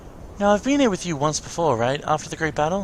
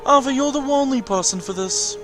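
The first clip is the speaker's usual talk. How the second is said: higher, insistent mentor voice; voiced master figure; 8 semitones higher than usual. Master telling the doubting apprentice they are chosen, higher mentor voice. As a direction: play the master singling out an apprentice.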